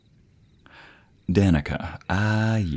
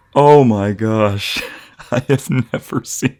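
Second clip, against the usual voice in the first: high, loud, laughing through the words; giggling in delight; 5 semitones higher, 6 dB louder, slower than usual. Acted. Amused by her man's belly laugh, laughing.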